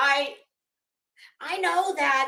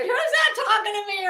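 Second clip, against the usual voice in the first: higher, louder imitated question; mock puzzled demand; 8 semitones higher, 5 dB louder than usual. Retelling Miss Ann's reply to a stranger's hello, mock puzzled. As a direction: play someone wondering who this stranger is.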